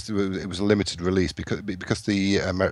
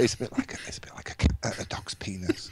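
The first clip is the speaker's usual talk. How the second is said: whispering; 3 semitones higher, 5 dB quieter than usual. Real, not acted. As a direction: whisper it under your breath. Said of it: "Why are you keeping your voice down?".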